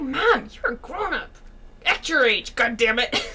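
slow, mock-exasperated telling-off; exasperated scolding. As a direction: scold Mom like she's the child. Acts out scolding her mom.

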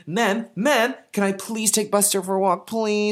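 put-on high-pitched begging kid voice; pestering kid. Kid nags mom to walk Buster, high pestering plea. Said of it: "he, like, bugs his mom".

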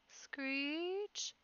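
high word sliding slowly upward; drawn-out screech. A narrator's reading. Armored figure nearing the terrified rabbits, eerie screech. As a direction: make the word imitate grinding metal. Narrator voices the metal's screech.

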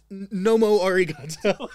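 giggling, loud punchline; barely getting the words out for laughing; laughing through it. Real, not acted. Cracking up at his own Japanese pun, laughing.

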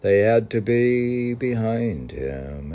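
a man reassuring himself aloud on the path. Acted. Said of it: "He said to himself".

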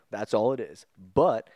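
ordinary talk, nothing marked

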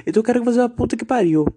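xingamento indignado, mais alto e agudo, rápido. Real, not acted. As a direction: manda pro inferno com raiva, acelerando. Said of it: "indignado pela primeira vez". (raiva real, mais alto, agudo e rápido)

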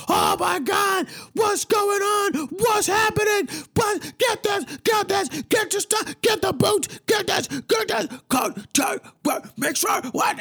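high, shouting panicked voice; panicked workers. Retold or imitated. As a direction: play the frantic cleanup crew.

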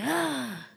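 soft breathy mock moan; quiet mock orgasm sigh; mock climax moan. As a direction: imitate a man about to come. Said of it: "I'm about to bust".

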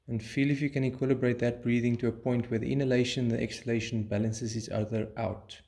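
usual speaking voice

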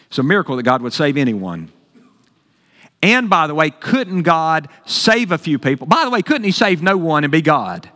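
ordinary talk

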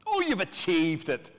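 high-pitched mocking congratulation; sarcastic. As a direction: pour sarcasm on their supposed success. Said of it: "this is Paul's sarcasm".

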